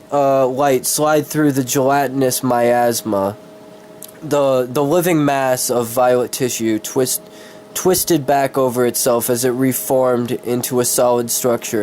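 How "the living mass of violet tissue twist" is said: ordinary delivery, nothing marked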